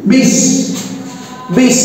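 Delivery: বিশ stopped sharply on the final শ; cut short. Shown as a demonstration. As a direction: clip বিশ to show a closed syllable. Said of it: "থেমে যাচ্ছে".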